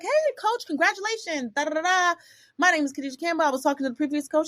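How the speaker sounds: sweet job-seeker voice greeting the new coach; mock eager greeting. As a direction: put on a high, overly eager hello. Retelling her eager call to coach.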